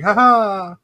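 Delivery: high, loud mock laugh; Borat. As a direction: crow a catchphrase-style "ha ha" as an impression. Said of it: "taking that character and putting him there and saying".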